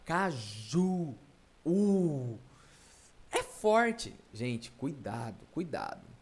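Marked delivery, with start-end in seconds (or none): 0.0-2.4 "Caju" with the final "ju" held long; stressed final syllable. Shown as a demonstration. Teacher shows pupils the strong syllable, stressed ending. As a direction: draw out the "u" of "Caju". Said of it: "presta atenção".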